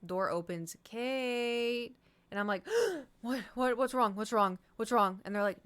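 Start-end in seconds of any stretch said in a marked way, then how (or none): 0.8-1.9 mock call. Roommates calling her awake. 3.2-5.2 startled panic. Retold or imitated. Jolted awake, alarmed.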